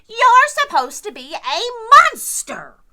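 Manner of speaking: high, loud scolding shout. Acted. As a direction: insist he must act scary. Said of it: "shouted the group".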